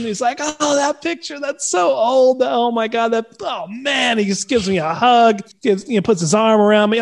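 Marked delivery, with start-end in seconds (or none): 0.4-4.2 high, imitated groaning amusement; retold amused dismay. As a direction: draw it out high, mortified but tickled. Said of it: "he's just laughing".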